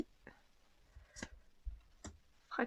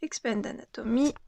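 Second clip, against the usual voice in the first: normal talking voice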